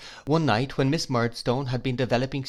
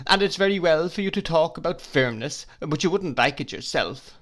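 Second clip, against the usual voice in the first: upset outcry; 6 semitones higher than usual. Acted. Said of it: "cried my mother".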